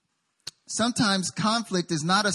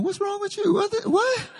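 baffled. Retold or imitated. Baffled reaction to her cheer.

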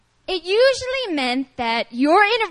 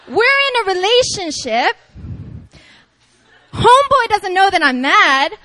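sassy, hammed-up complaint, louder; exasperated girlfriend; 3 semitones higher, 5 dB louder than usual. Girlfriend fuming over a clueless boyfriend, comic exasperation. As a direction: play the miffed girlfriend for laughs. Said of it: "This is from a girl's per".

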